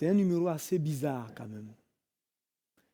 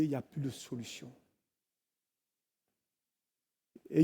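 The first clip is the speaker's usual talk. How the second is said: low, subdued voice of a man giving up; retold despair; 4 semitones lower, 4 dB quieter than usual. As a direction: voice his hopeless confession softly, with long pauses. (désespoir rapporté, voix basse et abattue)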